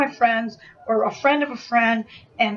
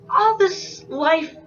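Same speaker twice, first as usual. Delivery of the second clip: ordinary delivery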